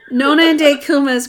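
talking through real laughter; amused laughing. Real, not acted. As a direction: tell it while still laughing at the mix-up. Laughing over grandkids' mistaken name.

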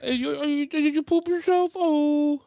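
put-on high squeaky baby talk. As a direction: coo at a newborn in a high voice. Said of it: "I talk to him like I have a handicap".

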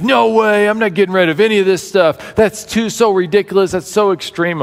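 imitating an indignant husband, pitched high; acted indignant refusal. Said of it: "absolutely indignant".

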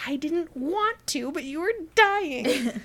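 slow, high sheepish excuse; sheepish pleading. Retold or imitated. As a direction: plead your case gently. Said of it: "He's like".

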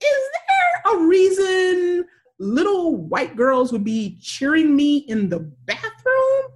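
high, slow mock bewilderment; retold baffled question. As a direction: draw out an incredulous question. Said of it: "I'm like".

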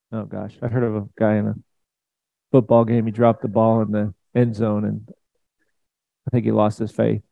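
ordinary talk, nothing marked

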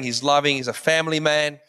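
ordinary delivery

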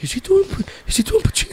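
high, hushed mock astonishment; awed audience member. Husband in the audience realizing it's Pacino, high mock awe. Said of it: "and he goes".

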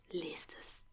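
whispered urging. Real, not acted. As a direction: breathe it out. Urging listeners softly.

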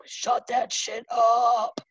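slow, drawn-out mock scold; annoyed people. People imitated slowly scolding noisy researchers. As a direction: drag it out like fed-up neighbours. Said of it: "and people are like".

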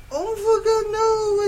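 high, loud wail; hammed-up falling scream. Girl falling through the opened floor, screaming. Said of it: "I screamed as I fell".

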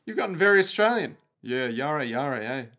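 put-on Australian accent. Jojo turned Australian, jokey mock Aussie accent. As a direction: lay on a jokey Aussie twang. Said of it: "We did Australian Jojo".

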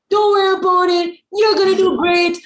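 high, bright imitation of an encourager; sing-song mock of a supportive partner; mock cheery pep talk.

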